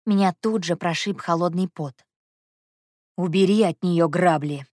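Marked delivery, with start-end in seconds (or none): 3.2-4.6 peremptory. A narrator's reading. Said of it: "Тоном, нетерпящим возражений".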